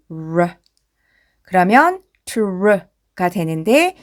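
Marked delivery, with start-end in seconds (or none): 0.1-0.5 "르" as an English r with curled tongue. Shown as a demonstration. Teacher showing the sound of R, tongue-curled "르". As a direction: curl the tongue for a lone "르". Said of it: "혀 굴리는 니을 소리".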